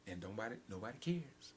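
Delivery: a quiet grieving aside; hushed lament. Acted. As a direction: let it fall away to near quiet. Sermon drops after outcry.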